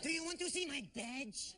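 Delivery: impression of a cop challenged on being real. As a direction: offer it slowly with mock authority. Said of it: "are you a real cop".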